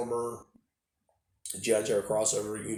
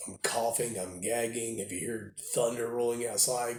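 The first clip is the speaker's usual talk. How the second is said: normal talking voice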